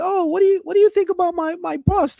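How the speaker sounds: high, sing-song imitation of women chatting; mimicking women. Mocking women asking a friend in high voice. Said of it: "they'll be like".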